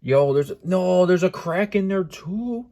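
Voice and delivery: high-pitched, drawn-out wail of dismay; distraught disbelief. Real, not acted. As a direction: react in real shock to fresh damage. Finds a crack as well.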